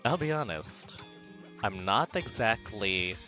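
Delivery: usual speaking voice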